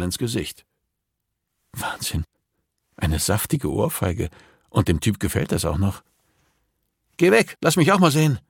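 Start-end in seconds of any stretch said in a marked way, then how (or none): 1.7-2.2 hushed, voiceless awe; amazed whisper. A narrator's reading. Watching the couple in secret, amazed whisper. 7.2-8.4 high, loud, fast demand; impatient demand. A narrator's reading. Max wants to look too, impatient and loud.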